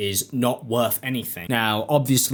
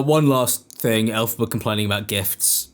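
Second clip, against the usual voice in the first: usual speaking voice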